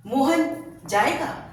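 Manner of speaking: Mohan stressed, drawn out slowly, rising; slow Mohan जाएगा leaning on Mohan. Shown as a demonstration. Teacher contrasting three tones, stressing Mohan slowly. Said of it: "तीनों बार हम जब अलग-अलग शब्दों पर".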